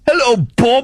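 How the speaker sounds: put-on high, silly English-accented call; mock English greeting. Host relaying listeners' hellos in a high mock-English accent. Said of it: "everyone on Instant Feedback is also saying hi".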